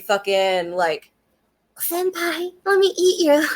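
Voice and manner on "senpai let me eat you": high-pitched cutesy yandere voice; moe yandere. Acted. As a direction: coo it high like an obsessed anime girl. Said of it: "she is like the O P yandere".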